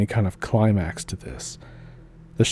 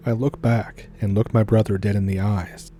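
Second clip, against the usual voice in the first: ordinary delivery, nothing marked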